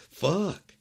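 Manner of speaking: sharp, high, loud outburst; anguished curse. Real, not acted. Viewer cursing as the film goes wrong, loud and high. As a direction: blurt it out in dismay. Reacting to movie plot.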